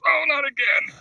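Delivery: high pained cry, hammed-up; mock anguish. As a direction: whimper it like a man in despair. Friend's second accident, high voice.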